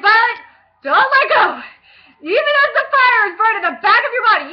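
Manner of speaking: loud, high desperate cry not to let go; urgent plea. Retold or imitated. Lincoln begging Violet to hold on, loud desperate plea. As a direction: beg her to hold on, loudly and high.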